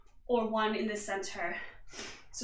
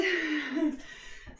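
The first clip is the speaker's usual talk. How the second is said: short laugh; 3 semitones higher, 3 dB quieter than usual. Real, not acted. Coach laughs switching sides.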